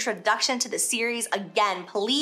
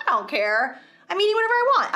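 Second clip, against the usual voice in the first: defiant don't-care; 9 semitones higher, faster than usual. Retold or imitated. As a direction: toss it off, defiant, rising high at the end. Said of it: "And then the next day I'd be like".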